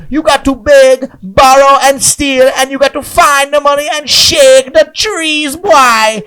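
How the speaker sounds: high, loud and slow preacher voice; loud, slow mock sermon; impression of a coworker. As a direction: preach it like a fired-up salesman. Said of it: "he would talk like this".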